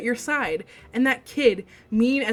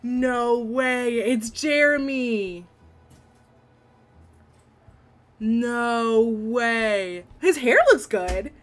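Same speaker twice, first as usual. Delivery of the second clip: excited; 3 semitones higher, 4 dB louder, much slower than usual. Real, not acted. Reader reacting to a character reveal.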